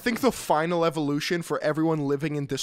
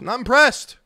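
louder, higher emphatic repeat; emphatic verdict; 7 semitones higher, 8 dB louder than usual. Acted. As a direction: punch it out, pitch jumping up on "impressed".

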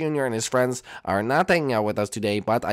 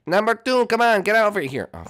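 normal talking voice

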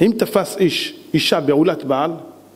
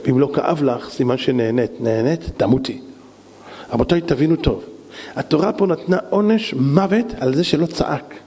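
usual speaking voice

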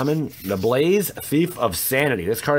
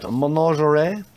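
mock-French menagerie, pitched higher with a rising end; French accent; 4 semitones higher than usual. Guessing how the French say the card name, mock French accent. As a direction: say it like a Frenchman would. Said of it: "that's how the French say it".

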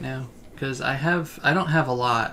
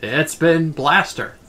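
half-hearted character yell, louder on the key word; drawn-out mock battle cry; 8 dB louder, much slower than usual. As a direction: call it out slowly like a catchphrase. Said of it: "I just had him yell".

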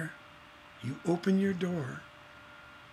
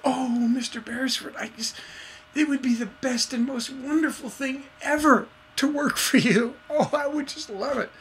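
high, put-on starstruck voice; gushing biggest fan; 8 semitones higher than usual. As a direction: gush like a thrilled superfan. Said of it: "your biggest fan".

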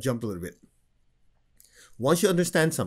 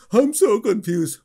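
louder, slow whiny lament; mock confused complainer; 8 semitones higher, 7 dB louder, slower than usual. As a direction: whine it like a lost student.

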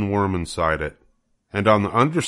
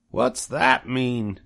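higher, very slow puzzled piglet question; puzzled pig Wilbur; 5 semitones higher, much slower than usual. Acted. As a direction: ask it slowly, confused. Wilbur asks.

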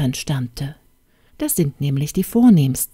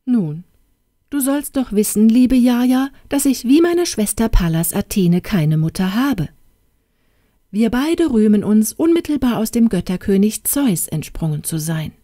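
prim fairy, stung and lecturing; somewhat irritated; 5 semitones higher than usual. Acted. Fairy godmother irritably correcting the princess. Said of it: "Die Fee sagte also etwas gereizt".

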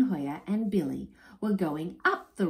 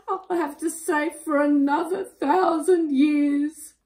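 high, loud, wailing dragon voice; sobbing; 11 semitones higher, 7 dB louder than usual. A narrator's reading. Dragon mourns his stolen gold and balloons, loud high sobbing. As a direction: bawl it out, hammed-up and woeful. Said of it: "he sobbed".

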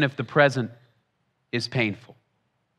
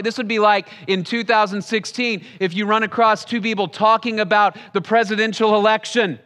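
ordinary talk

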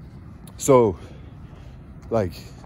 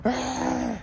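high mock wail on a single "Ah"; imitated toddler cry; 12 semitones higher, 6 dB quieter than usual. Father imitates his daughter bursting into tears, high mock wail. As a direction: wail it high like a three-year-old who knows she did wrong. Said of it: "she starts crying like".

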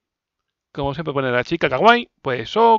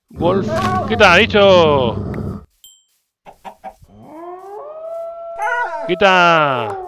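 loud, drawn-out shout; 7 semitones higher, 5 dB louder, much slower than usual. Real, not acted. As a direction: yell it at the monster. Yelling at mob.

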